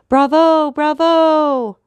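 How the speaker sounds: high, loud opera cheer; cheering bravo. Acted. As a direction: shout bravo at the singer.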